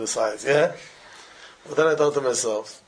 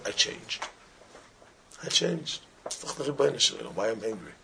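hushed confession; 5 semitones lower, 8 dB quieter than usual. Retold or imitated. Friend's quoted realization, hushed.

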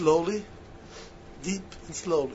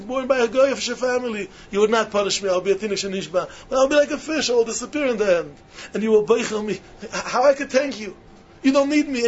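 higher, louder, pleading gratitude to God; fervent prayer; 6 semitones higher, 4 dB louder than usual. Acted. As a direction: pour it out like a heartfelt prayer. Preacher voices a grateful prayer.

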